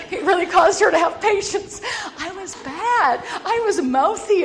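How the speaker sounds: high, loud, laughing through words; laughing confession. Real, not acted. Laughing as she admits she was mouthy. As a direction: giggle while admitting it. Laughing about being bad.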